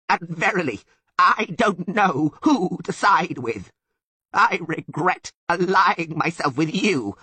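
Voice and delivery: high, loud, drawn-out indignant bleat; bleating Sylvan. A narrator's reading. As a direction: bleat bitter reproach, stretching the words. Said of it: "bleated the Sylvan".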